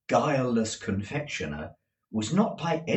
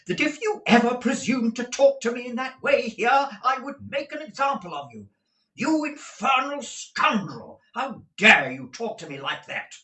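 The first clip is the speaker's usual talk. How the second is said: irate; 5 dB louder than usual. A narrator's reading. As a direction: scold him hard. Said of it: "suddenly became most irate".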